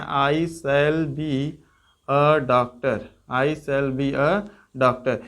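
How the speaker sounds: very slow, word by word; slow model sentence. Shown as a demonstration. Teacher dictating the English sentence slowly to the class. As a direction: spell it out slowly for students. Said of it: "इसको हम बना सकते हैं".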